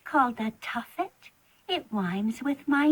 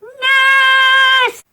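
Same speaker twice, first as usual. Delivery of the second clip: piercing high scream, much louder; terrified scream; 11 semitones higher, 15 dB louder than usual. Acted. Frightened child screaming for her nurse. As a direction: shriek for help at full volume.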